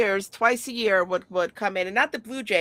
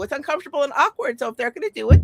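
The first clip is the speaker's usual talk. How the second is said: ordinary talk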